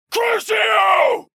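very high, loud, drawn-out spell cry; shouted curse. Acted. Sirius casting the curse on his victim, screaming.

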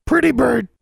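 high, loud bird squawk; cheeky high-pitched bird voice; retorting raven. Acted. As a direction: squawk it back like a talking raven. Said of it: "Charlie retorted".

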